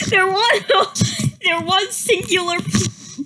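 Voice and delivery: words through real laughter; reading and cracking up at own story; breaking up laughing. Real, not acted. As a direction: lose it mid-line.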